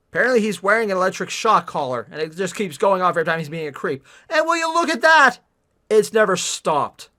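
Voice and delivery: loud, quick sarcastic riff; loud heckling of the story; mocking commentary. Real, not acted. Mocking the book loudly.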